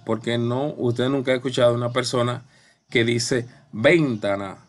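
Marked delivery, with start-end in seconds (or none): 3.7-4.6 VENtana, first syllable punched, deliberately wrong; stress on VEN. Shown as a demonstration. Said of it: "una persona que diga así".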